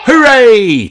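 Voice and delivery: high, loud happy cheer; loud royal hooray; joyful cheer. A narrator's reading. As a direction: cheer with all your heart. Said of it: "cheer King and Queen Thistle".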